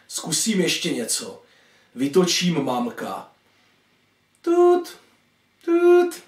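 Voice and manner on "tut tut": high, louder imitated dial tone; phone ring tone. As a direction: voice the phone's beep on a high note. (vysoké napodobené tútání telefonu)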